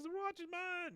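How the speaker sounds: put-on high-pitched childish protest; imitated kid's whine, very high. Boy protests again, then runs.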